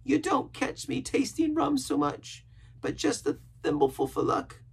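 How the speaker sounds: very high, earnest sailor voice; pious rambling castaway. A narrator's reading. Castaway telling Jim of his pious youth, earnest.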